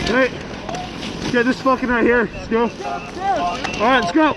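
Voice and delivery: high urgent yelling; firefighter shouting through mask. Real, not acted. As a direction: push them forward, shouting. Urging crew forward.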